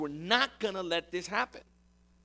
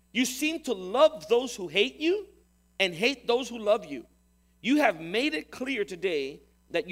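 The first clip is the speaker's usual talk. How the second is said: louder, indignant general scolding the king; stern rebuke; 2 semitones higher, 4 dB louder than usual. Acted. Joab confronting the grieving king, rising to an angry rebuke. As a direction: hammer each accusation home.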